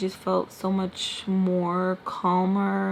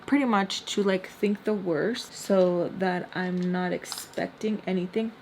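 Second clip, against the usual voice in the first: usual speaking voice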